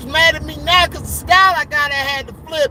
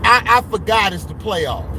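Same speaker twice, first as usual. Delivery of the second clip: lower, sheepish mock apology; imitating a friend; 6 semitones lower than usual. Imitating a friend apologizing on stream, mock sheepish. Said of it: "The H D said".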